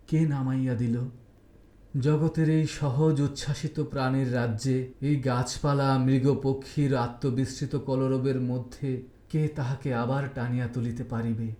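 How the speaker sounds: ordinary delivery, nothing marked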